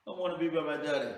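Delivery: mock crying child. Crying boy tells mom he wants his father, mock wailing. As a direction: wail it like a tearful little kid. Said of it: "he starts crying".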